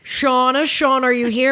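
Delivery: high affected roll-call voice; Jim, drunk and affected. Retold or imitated. Drunk manager taking roll call of his staff, affected. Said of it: "He's so drunk".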